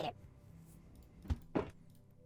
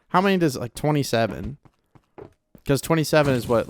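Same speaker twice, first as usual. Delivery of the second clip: ordinary talk, nothing marked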